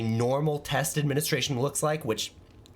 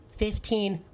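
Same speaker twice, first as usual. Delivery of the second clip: lightly voiced little boy, higher; child answering; 8 semitones higher than usual. Johnny reading the next plate, child voice. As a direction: give a simple kid's answer, pitched up. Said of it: "He's like".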